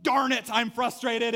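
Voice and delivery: loud, slow frustrated exclaim; frustrated outburst. Retold or imitated. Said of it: "so frustrated".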